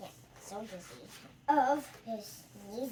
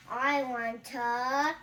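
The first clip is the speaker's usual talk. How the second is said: pre-sneeze buildup; 6 dB louder, much slower than usual. Acted. Gerald about to sneeze.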